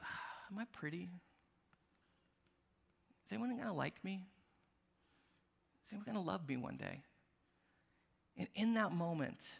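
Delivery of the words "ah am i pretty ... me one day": soft, much quieter, worried self-doubt; insecure little girl. Retold or imitated. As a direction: let it shrink into a small worried question. Daughter's insecure thoughts voiced.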